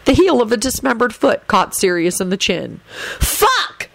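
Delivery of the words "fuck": high-pitched yelp at normal volume; sudden high cry; yell. A narrator's reading. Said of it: "he yelled".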